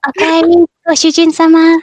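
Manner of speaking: put-on high and loud anime girl greeting; sugary cartoon maid welcoming her master; cute anime maid voice. Shown as a demonstration. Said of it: "that cute anime girl Oni-chan voice".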